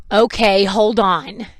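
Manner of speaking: loud, put-upon reply; mock frustrated compliance. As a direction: act out an annoyed clerk giving in. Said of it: "being all mad and frustrated with me".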